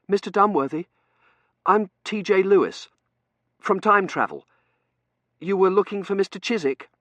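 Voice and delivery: put-on high, loud nervous young man. A narrator's reading. Young man from Time Travel approaching Dunworthy, nervously. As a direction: introduce yourself anxiously in a high voice. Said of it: "said nervously".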